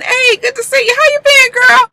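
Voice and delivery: a hammed-up breezy greeting; voicing Fitz. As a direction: bounce in high and friendly.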